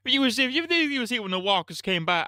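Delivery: high-pitched put-on accent for Shane; switched accent. Shane confronting Rick over Lori, high put-on accent. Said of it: "switched his accent in the middle".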